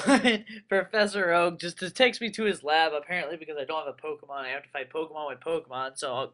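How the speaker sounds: normal talking voice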